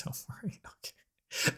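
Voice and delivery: hushed, barely voiced; whispered aside. Real, not acted. Player murmurs to the character, whispered. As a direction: whisper it under your breath. Quiet aside while playing.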